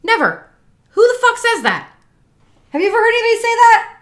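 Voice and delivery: loud, high, indignant outburst; real exasperated rant, punching it out; fired up. Real, not acted. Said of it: "It's 'cause they're fired up".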